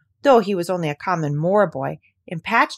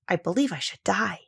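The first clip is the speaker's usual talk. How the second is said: ordinary delivery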